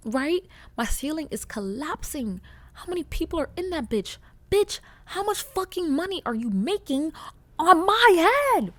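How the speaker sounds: high, rising outraged yell; yelling at neighbor. Acted. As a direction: shout it up at the ceiling in disbelief. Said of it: "Sorry, I just had to yell that".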